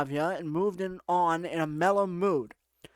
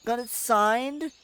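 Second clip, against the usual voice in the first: higher, drawn-out exasperated delivery; put-on irritated girl; 5 semitones higher, slower than usual. Alyssa confronts him at the club, exasperated higher voice.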